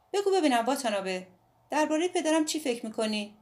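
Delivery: put-on a defiant girl explaining herself; Midori's voice. A narrator's reading. Midori asks about father.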